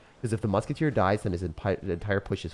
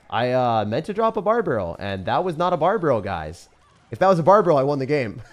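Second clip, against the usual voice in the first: much higher and louder frustrated rant; furious gamer outburst; exasperated yelling; 7 semitones higher, 9 dB louder than usual. Real, not acted. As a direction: vent at the chat. Loud rant over misclick.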